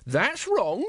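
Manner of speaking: high-pitched woman in the crowd. Acted.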